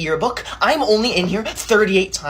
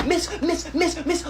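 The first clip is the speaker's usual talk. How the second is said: whiny tattling; 9 semitones higher, 6 dB quieter, slower than usual. Acted. Tattling to teacher.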